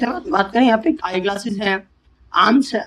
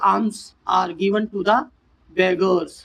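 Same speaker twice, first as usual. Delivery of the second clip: very slow, word by word; slow dictation; much slower than usual. Shown as a demonstration. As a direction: lay out each word for the class. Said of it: "example sentence dictated".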